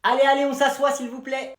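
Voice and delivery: very high, loud call to the class; put-on high-pitched teacher's call. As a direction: shout the unruly pupils into their seats. Teacher calls class to sit.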